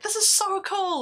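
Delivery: high, delighted exclamation; excited. Retold or imitated. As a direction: gush about the snow. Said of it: "And I'm like".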